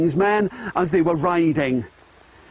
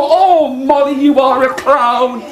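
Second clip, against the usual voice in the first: crowd's teasing call; 6 semitones higher than usual. Acted. Everyone teasing Molly the clown, high sing-song call. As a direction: call it out high, stretching the words. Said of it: "everyone would go".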